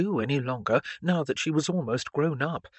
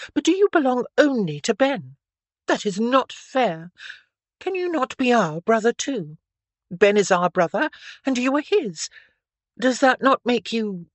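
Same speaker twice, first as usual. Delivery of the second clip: put-on higher, louder girlish pleading; eager, questioning Stephanie; 8 semitones higher, 6 dB louder than usual. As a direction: press the questions in a higher, insistent voice. Narrator voices young Stephanie in dialogue.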